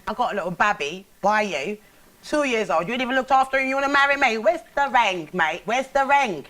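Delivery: put-on sassy Cockney girlfriend demanding the ring; Cockney, rising to a scolding "where's the ring". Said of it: "now let's do that in, in Cockney".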